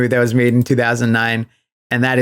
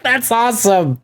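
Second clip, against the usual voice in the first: higher, sarcastic "That's awesome"; mock hype; 8 semitones higher than usual. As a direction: fake delight at a post-credit scene. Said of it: "And I'm like".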